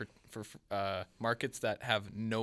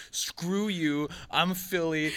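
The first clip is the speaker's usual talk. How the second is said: raised, brash fan defiance; Philadelphia attitude; 5 semitones higher, 4 dB louder, much slower than usual. Acted. Voicing a Flyers fan among rivals, Philadelphia attitude. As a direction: play a defiant Philly fan. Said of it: "Philadelphia attitude that's just like".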